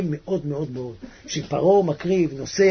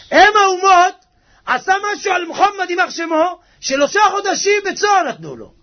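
higher-pitched, full-voiced preacher's protest; indignant outburst; 13 semitones higher, 6 dB louder than usual. Real, not acted. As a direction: burst out in raised indignation. Preacher erupts over the nations.

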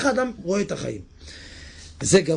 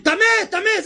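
high loud call; high mock leper cry; leper shouting; 4 dB louder, slower than usual. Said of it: "אז הוא מתכוון".

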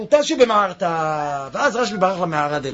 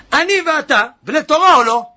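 higher, louder insistent plea to his son; urgent appeal; 7 semitones higher, 6 dB louder than usual. Acted. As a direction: press the son hard, father to son. Rashbi urging his son.

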